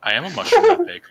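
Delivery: loud, high mock mushroom boom. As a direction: shout it out as a proud mushroom. Player answers as mushroom.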